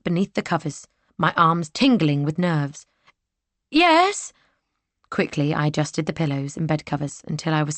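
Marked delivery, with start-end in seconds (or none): 3.7-4.3 called out. Acted. Heroine answers a knock.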